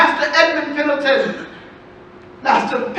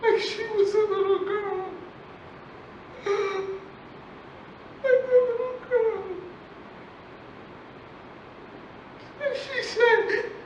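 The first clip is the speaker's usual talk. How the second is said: tearful, on the edge of crying; 7 semitones higher, 10 dB quieter than usual. Acted. Mother recalling her daughter's goodbye, through tears. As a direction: voice breaking with tears, a little higher.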